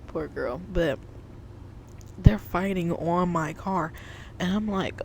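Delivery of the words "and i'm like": usual speaking voice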